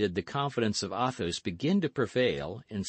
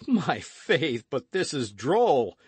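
high, louder amused exclamation; delighted, raised-voice amusement; cried with laughter; 6 semitones higher, 6 dB louder, slower than usual. A narrator's reading. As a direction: exclaim it as if convulsed by the joke. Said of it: "holding his sides with laughter".

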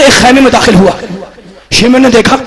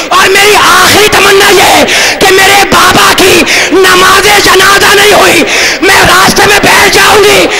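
keening wail; 8 semitones higher than usual. Acted. Orphan's three wishes, climactic wailing.

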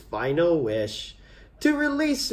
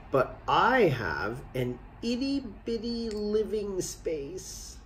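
genie; 4 semitones higher, 5 dB quieter than usual. Acted. Genie jokes about tiny lamp.

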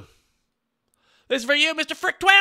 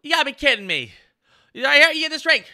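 usual speaking voice

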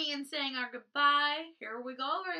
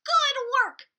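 higher, louder cheerful praise; sisters' voice; 11 semitones higher, 11 dB louder than usual. Acted. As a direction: sing out the praise. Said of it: "said the sisters".

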